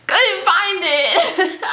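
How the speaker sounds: high, very drawn-out singsong; comic slow, awkward admission; sheepish singsong. Retold or imitated. Acts reporting back to man.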